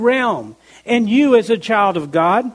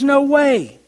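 a higher, firm "No way."; emphatic refusal; 5 semitones higher than usual. Retold or imitated. Preacher voicing Paul's emphatic refusal, mild. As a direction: slam the door on it, lightly. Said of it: "he could not be more emphatic".

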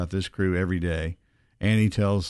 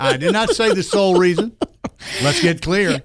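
laughing protest; 9 semitones higher, 4 dB louder than usual. Real, not acted. Defending himself laughing.

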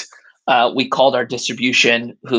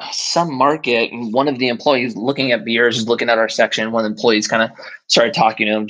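ordinary talk, nothing marked

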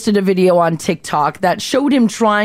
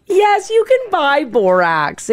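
laughing through the words; 7 semitones higher, slower than usual. Real, not acted. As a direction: insist while laughing. Playful argument about borax.

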